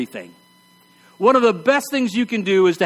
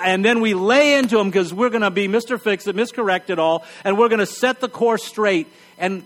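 usual speaking voice

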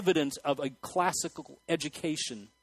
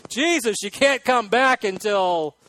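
comic bargaining; 4 semitones higher, 6 dB louder, slower than usual. Retold or imitated. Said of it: "You know".

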